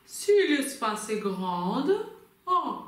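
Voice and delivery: loud, slow, roomy resonance; big, spacious singer's voice, stretched out; round, ample voice. Shown as a demonstration. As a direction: open wide inside and let the words ring full. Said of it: "ça sonne directement plus rond".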